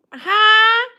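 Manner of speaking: ordinary talk, nothing marked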